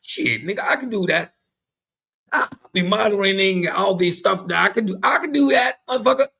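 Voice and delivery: high, loud brash street talk; cocky slangy applicant. Retold or imitated. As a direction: brag like a cocky street guy. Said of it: "When you go to an interview".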